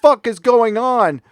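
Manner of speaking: loud, high and slow disbelief; shocked outburst. Retold or imitated. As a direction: yell it in total disbelief. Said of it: "I'm like".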